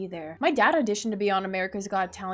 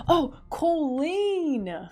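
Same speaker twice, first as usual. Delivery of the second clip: slow drawn-out; 5 semitones higher, much slower than usual. Real, not acted. Drawn-out reading of option.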